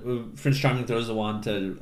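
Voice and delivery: ordinary delivery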